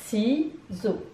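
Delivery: "Ciseaux" as ci, short pause, seaux; syllable by syllable. Shown as a demonstration. Teacher recapping two syllables, "Ciseaux" separated as ci, seaux. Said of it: "Deux syllabes".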